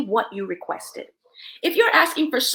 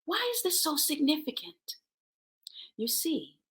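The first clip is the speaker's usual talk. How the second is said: whispering; 2 semitones higher, 8 dB quieter than usual. Real, not acted. Dramatic aside to viewers.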